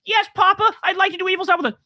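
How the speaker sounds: high, simpering yes-man voice; lightly mocking Rubio as a sycophant; mock eager lackey. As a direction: play a fawning little servant.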